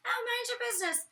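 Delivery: squeaky high mocking voice; mock her in a high squeak; high-pitched mocking. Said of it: "in a really high-pitched voice".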